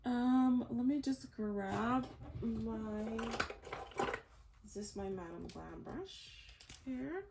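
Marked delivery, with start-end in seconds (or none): none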